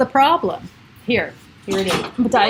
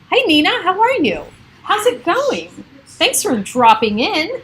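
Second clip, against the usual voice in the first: bright, much higher hello to a viewer; cheery greeting; 7 semitones higher than usual. Real, not acted. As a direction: welcome her in warmly and high. Greeting viewer joining live.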